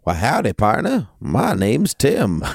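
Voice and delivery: light American cowboy accent, a hammed-up howdy. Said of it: "my American accent just comes out in full force".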